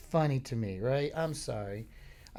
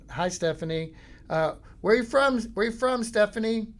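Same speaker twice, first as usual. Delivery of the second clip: high, loud repeated shouting; yelling at bar; 9 semitones higher, 6 dB louder than usual. Retold or imitated. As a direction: shout the question over the music.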